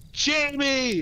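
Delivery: high-pitched name call; acted calling out. Superhero picking a kid from the crowd, high call. Turbo Man picks boy from crowd.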